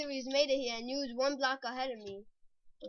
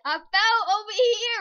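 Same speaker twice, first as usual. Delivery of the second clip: higher, louder insistence; loud protest; 8 semitones higher, 10 dB louder than usual. Real, not acted. Player rebuts a cheating accusation, loud protest. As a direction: protest it loudly through a laugh.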